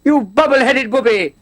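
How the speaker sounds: high, loud, fussy campy whine; Doctor Smith impression. For the interviewer on request, high fussy Doctor Smith voice. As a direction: play the prissy coward begging to be left alone. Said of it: "I used to imitate Doctor Smith a lot".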